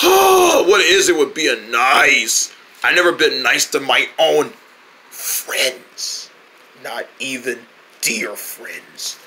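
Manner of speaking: low, slow grumbling grouch; grumpy voice. Acted. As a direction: grumble it like a sourpuss. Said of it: "you're the grumpy one".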